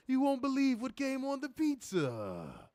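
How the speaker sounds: high, slow Triple L voice; Triple L impression. Finishing the pizza bit for chat, high and slow. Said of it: "I quite like the Triple L impressions".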